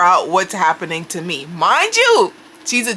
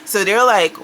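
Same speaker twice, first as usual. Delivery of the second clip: normal talking voice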